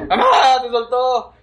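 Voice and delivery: shouting to mom that she came loose, through laughter; retold panicked yell. Son laughing while reenacting a yell to his mom. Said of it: "Me empecé a gritar a mi mami".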